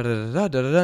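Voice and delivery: normal talking voice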